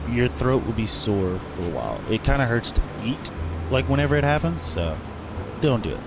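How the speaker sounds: normal talking voice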